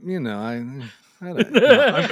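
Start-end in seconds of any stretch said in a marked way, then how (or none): 1.2-2.1 laughing. Real, not acted. Jokingly pretends not to answer.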